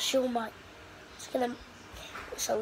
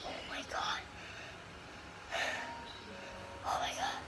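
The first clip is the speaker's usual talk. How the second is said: breathless awestruck whisper; whispered awe; 16 dB quieter, much faster than usual. Real, not acted. Gamer stunned into silence, whispered awe. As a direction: breathe it out in hushed disbelief.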